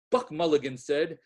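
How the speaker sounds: ordinary delivery, nothing marked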